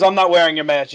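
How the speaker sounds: loud, indignant retold refusal; defiant. Gym customer refusing the worker's mask, loud mock defiance. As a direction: dig in, imitating a stubborn customer.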